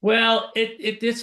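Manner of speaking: ordinary talk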